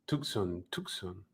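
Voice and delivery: Tucson as Spanish tʰukson, with a pure u and o; Spanish accent. Shown as a demonstration. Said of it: "My Spanish accent".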